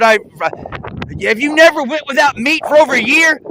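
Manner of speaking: ordinary talk, nothing marked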